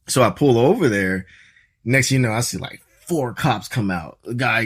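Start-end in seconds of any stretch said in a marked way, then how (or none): none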